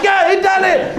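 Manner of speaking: high jubilant scream; cheering. Acted. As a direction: shout it like a celebrating crowd. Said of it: "everyone's cheering".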